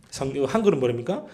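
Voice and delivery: ordinary talk, nothing marked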